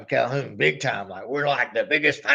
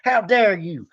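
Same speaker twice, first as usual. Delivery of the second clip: higher, quick mock outrage; 7 semitones higher, 4 dB louder than usual. Fan scolding [name] for not liking [name], quick mock outrage. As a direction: snap it like a betrayed fan. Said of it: "I look over at [name] and I'm like".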